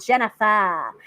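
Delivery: imitated Massachusetts accent with a dropped R; mock Massachusetts accent, open "ah" ending. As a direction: say it like a Massachusetts mom, "Jenna-fah". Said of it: "you know, with the accent".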